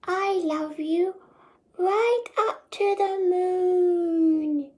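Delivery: high, slow, sleepy bunny; sleepy little hare. Acted.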